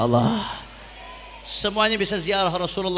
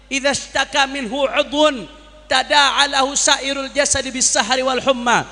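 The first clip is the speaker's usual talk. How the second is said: ordinary talk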